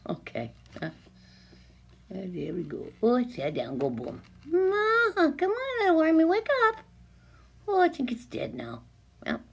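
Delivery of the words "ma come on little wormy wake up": high sing-song coaxing to a tiny worm. Real, not acted. To the worm under the lens, high coaxing baby talk. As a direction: sweet-talk it awake, pitched up high.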